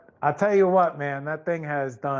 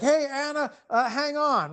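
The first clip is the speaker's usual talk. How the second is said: higher call across the room; 8 semitones higher than usual. Retold or imitated. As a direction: call out to Anna to wait. Calling out to Anna.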